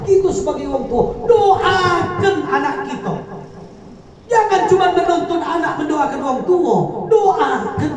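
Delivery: loud, high preaching at full force; fervent preaching. Acted. Preacher urging parents to pray for their children, loud and fervent. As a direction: drive the message home to the crowd.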